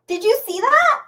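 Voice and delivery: ordinary talk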